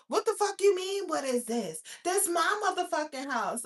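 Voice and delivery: acted-out loud, high protest; retold indignant yelling; angry challenge. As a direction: challenge an intruder, outraged. Acting out the woman's angry challenge.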